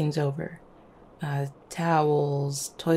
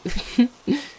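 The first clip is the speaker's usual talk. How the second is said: short, high giggle; light giggle; 7 semitones higher, 4 dB louder than usual. Acted. Partner teasing their beloved, light giggle. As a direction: giggle at your own teasing.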